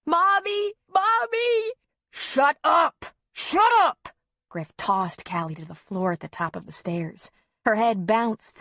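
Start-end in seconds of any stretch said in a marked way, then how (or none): 0.0-1.7 put-on very high, drawn-out child's wail; hysterical wailing. A narrator's reading. Small child screaming for mother, hysterical wail. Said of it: "Callie's hysterical wails". 2.1-4.1 high, loud shout; furious ranting. A narrator's reading. Father over child's wails, furious shout. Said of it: "Griff's ranting".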